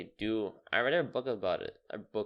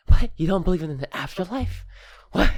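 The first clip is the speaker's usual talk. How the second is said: shocked disbelief; 10 semitones higher, 10 dB louder than usual. Retold or imitated. Imitating friends' shocked reaction.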